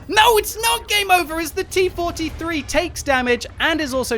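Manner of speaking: high shouted reversal; excited shout. Real, not acted. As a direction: yell it in disbelief. Sudden reversal on the clock.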